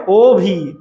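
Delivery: ওভি with a held ও, then ভি; teacher's demonstration of ওভি in two beats; two-syllable demo. Shown as a demonstration. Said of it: "মুখের shape দুইবার change হচ্ছে".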